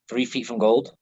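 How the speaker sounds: ordinary delivery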